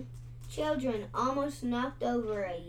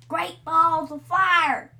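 loud crackling exclamation; crackling dragon; 5 semitones higher, 9 dB louder than usual. A narrator's reading. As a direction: crackle it like fire. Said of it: "crackled the dragon".

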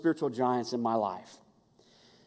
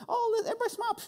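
mock high voice; much faster than usual. People staging a photo.

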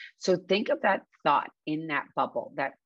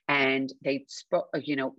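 ordinary talk, nothing marked

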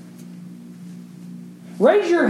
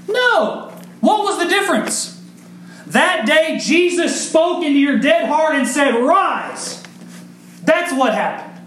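preaching at full force; 9 semitones higher than usual. Acted. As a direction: build the sermon to a fervent peak. Climactic preaching run.

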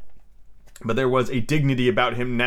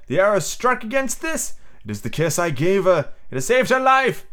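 high, emphatic wonder; excited discovery; 7 semitones higher than usual. A narrator's reading. Peter explaining how his kiss saved Wendy, excitedly. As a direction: announce it eagerly, pitched well up.

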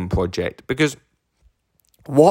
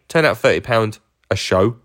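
ordinary delivery

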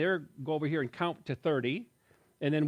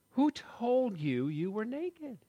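slow gentle voice of God; 8 semitones higher, much slower than usual. Acted. God questioning Adam.

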